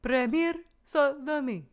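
sing-song it like a silly show jingle; mock French jingle. Host proposes the phrase as the podcast jingle, in mock French.

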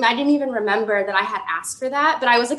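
ordinary talk

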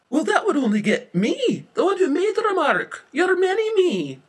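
very high, loud squeal; panicked outburst. Acted. Witness panics about being implicated.

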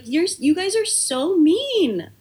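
high, slow hurt whine. Retold or imitated. Babysitter whining at the mean kids, high and slow. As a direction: plead with them, slow and high. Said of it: "I was like".

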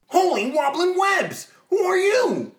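high, loud heroic exclamation; Spider-Man voice. Acted. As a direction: shout it out, way up high.

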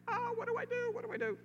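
very high, panicked mock wail; frantic parent. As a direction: sound at your wits' end. Frantic parent with crying baby.

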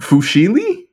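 "Fusilli" said high as foo-SHEE-lee, rising; questioning mispronunciation. Shown as a demonstration. Repeating it at co-host's request, high questioning foo-SHEE-lee for "Fusilli". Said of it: "say it again".

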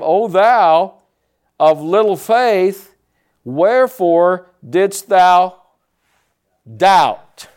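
measured King James rebuke; slow solemn Jesus. Retold or imitated. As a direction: lay each word down gravely. Jesus quote, KJV, slow.

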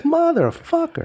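squeaky high-pitched mock curse, drawn out slowly; exasperated outburst. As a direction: yelp it in disbelief at getting sick. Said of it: "It's like".

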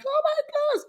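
very high squeaky lament; tiny high-pitched wail; mock dismay. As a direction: squeal it in imitation of a panicking boyfriend. Mimics ex seeing clothes thrown out.

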